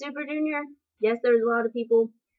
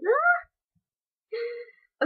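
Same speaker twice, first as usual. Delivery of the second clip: high squeal; 10 semitones higher than usual. Real, not acted. Squeal seeing idol dance.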